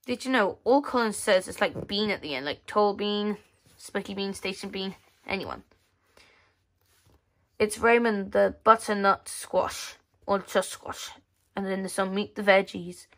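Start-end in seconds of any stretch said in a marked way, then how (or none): none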